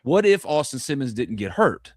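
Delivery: ordinary talk, nothing marked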